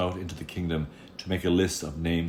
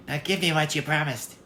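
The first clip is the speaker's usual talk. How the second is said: a sly little goblin bargaining; impish little man; 11 semitones higher than usual. Acted. Little man bargains with the girl, high and impish.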